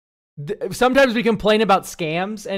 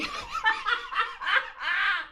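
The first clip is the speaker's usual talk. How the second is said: high laugh; 5 dB quieter than usual. Real, not acted. Laughing at AI roast.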